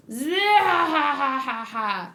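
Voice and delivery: hammed-up pirate villain cackle; villain laugh. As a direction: stretch out a mock evil "zeehahaha". Reading a manga villain's laugh aloud.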